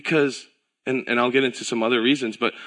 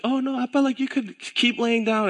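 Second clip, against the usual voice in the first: put-on much higher, small child's voice; little daughter; 13 semitones higher than usual. Dad imitating his daughter Eden at bedtime, high child voice. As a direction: lightly play the little girl urging dad to rest. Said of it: "she's like".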